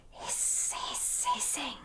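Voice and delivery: soft, sibilant whispered hisses; snake hissing. A narrator's reading. Narrator lists the sounds Edward hears, hissing. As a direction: hiss it like a snake.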